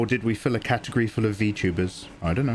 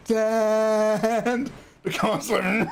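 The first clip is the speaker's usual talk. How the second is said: laughing; 11 semitones higher, much slower than usual. Real, not acted. Laughing while describing crash.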